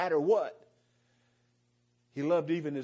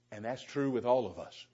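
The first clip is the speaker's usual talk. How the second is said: usual speaking voice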